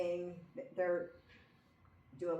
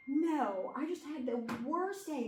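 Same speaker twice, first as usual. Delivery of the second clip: retold sobbing; 7 semitones higher than usual. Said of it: "I'm just sobbing".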